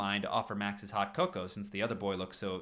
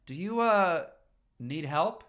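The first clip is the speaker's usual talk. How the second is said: calling out; 8 semitones higher, 7 dB louder, slower than usual. Acted. Calling to stranger on curb.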